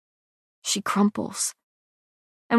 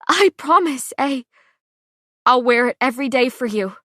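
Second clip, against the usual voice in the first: sob-laugh promise; 4 semitones higher, 5 dB louder than usual. A narrator's reading. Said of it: "sob and hysterical laugh".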